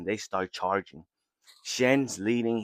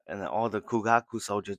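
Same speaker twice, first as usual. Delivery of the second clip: ordinary talk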